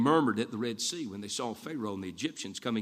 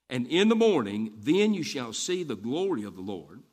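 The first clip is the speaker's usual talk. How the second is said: raised, preaching scripture read; booming Bible reading; 4 semitones higher, 5 dB louder than usual. Acted. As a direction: proclaim the verses from the pulpit. Reading scripture aloud at force.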